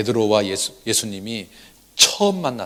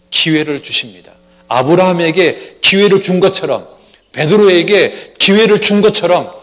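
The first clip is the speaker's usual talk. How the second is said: louder, higher preaching voice; full-force preaching; rising sermon fervor; 5 semitones higher, 5 dB louder than usual. Real, not acted. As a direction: lift the congregation with conviction.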